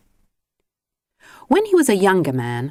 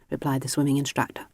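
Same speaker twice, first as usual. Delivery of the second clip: plain reading voice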